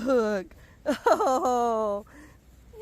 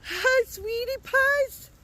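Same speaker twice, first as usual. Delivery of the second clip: high, slow, drawn-out greeting; cooing to pets; 5 semitones higher, slower than usual. Real, not acted. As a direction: coo sweetly to the animals. Greeting the pigs.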